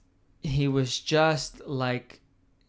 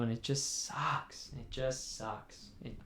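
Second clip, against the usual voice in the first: dejected; 12 dB quieter than usual. Real, not acted. Mourning Klopp leaving.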